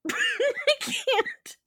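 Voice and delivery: high, loud helpless laughter; can't get through it for laughing; breaking up mid-read; laughing fit. Real, not acted. Laughing fit over a word.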